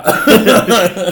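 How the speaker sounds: laughing loudly while talking. Real, not acted. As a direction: laugh through the words.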